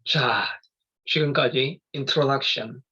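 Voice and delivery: ordinary talk, nothing marked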